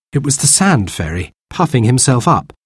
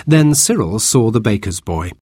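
ordinary delivery, nothing marked

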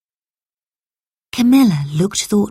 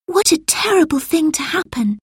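put-on young girl voice; 11 semitones higher than usual. A narrator's reading. Lucy voiced as child.

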